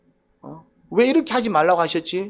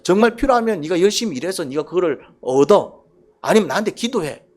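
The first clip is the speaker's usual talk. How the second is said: ordinary talk, nothing marked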